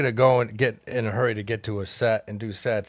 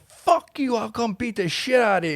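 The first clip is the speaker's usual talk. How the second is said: mock angry yell; 8 semitones higher than usual. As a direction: imitate an angry little guy yelling back. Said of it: "he's yelling".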